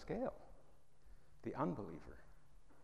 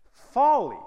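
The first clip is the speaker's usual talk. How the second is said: scornful; 11 semitones higher, 13 dB louder than usual. Acted. Scornful verdict on the absurd claim.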